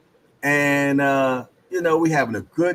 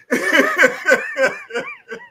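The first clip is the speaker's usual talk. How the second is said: loud, very high laughing fit; high-pitched cackle; 4 dB louder than usual. Real, not acted. Joking that it ain't his kid, high cackle. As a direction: crack up at your own joke.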